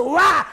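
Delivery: loud high yelp as the plane shakes; hammed-up turbulence scream; mock scream.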